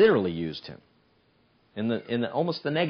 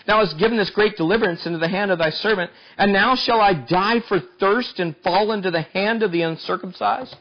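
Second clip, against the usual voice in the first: raised, louder preaching read; scripture reading; 8 semitones higher, 4 dB louder than usual. Acted. Preacher reads Samson's cry to God, raised and ringing. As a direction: read the old words out with force.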